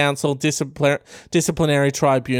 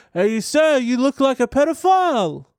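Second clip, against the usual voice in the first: high-pitched cheeky schoolkid taunt; taunting student; 9 semitones higher than usual. Acted. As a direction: heckle the teacher in a raised mock-kid voice. Acts out student taunting teacher.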